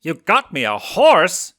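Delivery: loud, high, slowed amazed question; excited. A narrator's reading. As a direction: gasp it out, thrilled. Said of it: "eyes popping excitedly".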